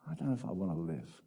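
low, subdued mock despair. Preacher voicing a person who finds despair, quietly despairing. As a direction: voice a weary thought, dropped low and soft.

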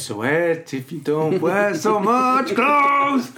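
stretched-out mock whine, climbing in pitch; mock whining. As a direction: drag every word, rising to a wail. Whines back at the judge's ruling.

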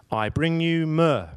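slow, loud nativity proclamation; solemn little boy. Retold or imitated. Second wise man at the manger, very solemnly. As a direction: intone it like an earnest child actor. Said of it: "and says very solemnly".

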